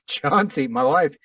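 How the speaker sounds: normal talking voice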